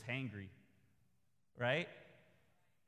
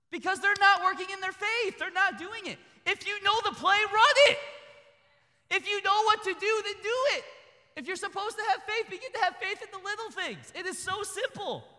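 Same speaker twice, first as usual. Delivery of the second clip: high, loud, urgent preacher's cry; full-force sermon call to act in faith; fiery preaching; 9 dB louder than usual. Acted. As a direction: drive the point home to the congregation.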